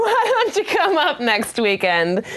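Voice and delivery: high, slow, silly quote voice; imitated suitor's invitation. French date invites her to Canada, high mock coaxing.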